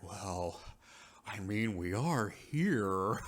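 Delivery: slow, drawn-out mock reluctance; mock coy temptation. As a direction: stretch it out, pretending to need persuading. Voicing Malcolm, tempted by offer.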